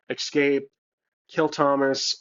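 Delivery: ordinary talk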